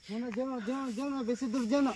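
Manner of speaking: scared plea. Real, not acted. Begging friend not to go.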